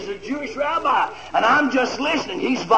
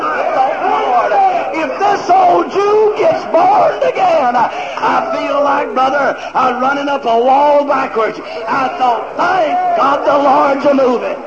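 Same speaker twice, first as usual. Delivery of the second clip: loud, high-pitched revival shouting; full-force preaching; 5 semitones higher, 4 dB louder than usual. Real, not acted.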